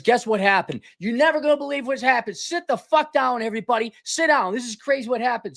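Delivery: high, mock-breathless hype; comic over-the-top hype about a printer; hyped-up excitement. Real, not acted. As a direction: build it up like huge news. Hyped build-up to printer story.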